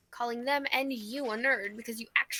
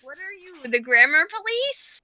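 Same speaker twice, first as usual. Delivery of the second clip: mock indignant; 5 semitones higher, 10 dB louder than usual. Real, not acted. Wife bristling at grammar correction, mock indignant. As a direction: climb in pitch and volume on the question. Playful quarrel over grammar.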